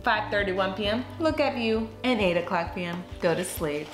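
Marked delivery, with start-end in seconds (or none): none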